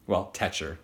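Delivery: "tetcher" with a short e, like "tet"; misspelled word read. Shown as a demonstration. Teacher reading the child's spelling aloud, "tetcher". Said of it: "She forgot the A".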